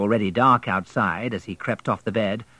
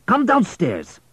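louder, higher, curt order; station master ordering the boy downstairs; commanding station master; 12 semitones higher, 5 dB louder, slower than usual. Acted. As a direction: bark it down at the boy. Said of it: "he commanded".

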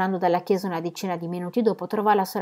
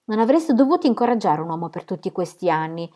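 plain reading voice

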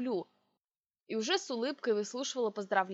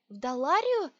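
frightened gasp; 4 semitones higher, 5 dB louder than usual. A narrator's reading. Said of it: "испуганно выдохнула я".

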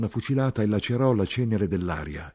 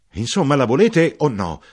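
high, much louder exasperated shout; impatient shout; 7 semitones higher, 10 dB louder than usual. Acted. Narrator shouting at the soldier to take the cigarette. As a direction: snap it out, losing patience.

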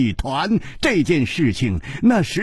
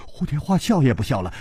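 plain reading voice

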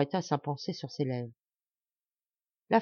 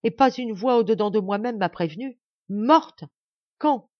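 raised, loud, anguished questioning; shocked distress; 4 semitones higher, 7 dB louder than usual. A narrator's reading. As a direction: cry out, voice climbing in disbelief. Grief-stricken man learns of death. (douleur stupéfaite, voix haute et forte)

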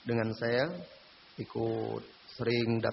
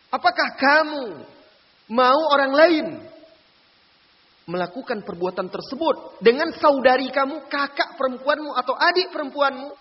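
high, loud preacher's challenge to the listener; forceful questioning; 12 semitones higher, 9 dB louder than usual. Retold or imitated. Preacher voicing the Prophet challenging the young man, loud and high. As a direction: hammer the question home at full voice.

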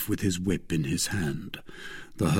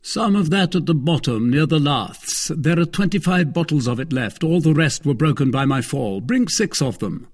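high, loud, commanding tippler; Athos ordering grandly; 7 semitones higher, 5 dB louder than usual. Acted. Athos ordering bottles from the cellar, commanding. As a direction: call out the order with relish.

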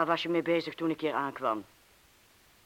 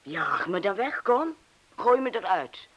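rising, louder dare; defiant challenge; 6 semitones higher, 6 dB louder than usual. Acted. Young woman dares the man to throw her out, defiant. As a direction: throw it at him, daring him.

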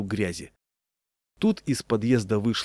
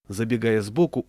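ordinary delivery

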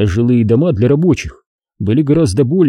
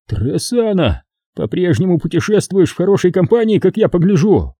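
wheezing from the floor; 7 semitones higher than usual. Acted. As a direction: rasp it out lying collapsed. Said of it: "прохрипел Дом с пола".

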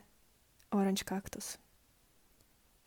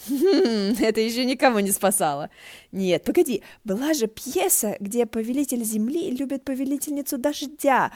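teasing; 3 semitones higher, 5 dB louder than usual. Acted. Teasing recollection of a play.

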